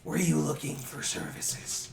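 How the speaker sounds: soft, slow, kindly goblin voice; kind gentle offer. Acted. Old goblin offering services to the player, soft and kind. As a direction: welcome them gently.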